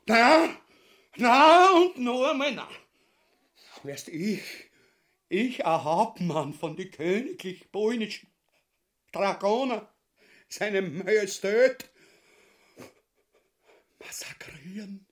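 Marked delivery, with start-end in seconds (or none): 0.1-2.7 high, loud repeated na; loud refusal. Acted. Ubu loudly refusing his wife's murder plan. 14.0-15.1 whispered in shock; horrified whisper. Acted. Ubu to his wife, whispering the word in horror.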